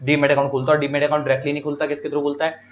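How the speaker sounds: normal talking voice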